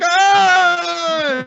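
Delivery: high, loud startled cry; shocked scream. Retold or imitated. Suika finds Ryusui's stone hand and eye, loud startled cry. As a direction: shriek like you just found a severed hand.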